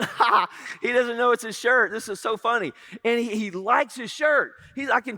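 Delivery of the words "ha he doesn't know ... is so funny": talking through a giggle; laughing at own prank. Retold or imitated. Uncle laughing at his own prank on his nephew. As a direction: giggle it out, barely holding in the laugh. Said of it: "I'm laughing because I'm thinking".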